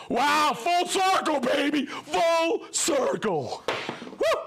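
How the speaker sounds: loud, high whooping shout; real over-excited holler; excited outburst. Real, not acted. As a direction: cheer it out at full voice, stretching the words. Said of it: "I get a little excited".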